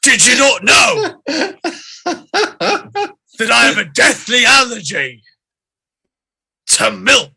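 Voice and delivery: hammed-up orc shout, high and loud; angry orc. Allergic orc to the cook serving milk, furious. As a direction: bellow it as an outraged orc. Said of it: "as the angry orc".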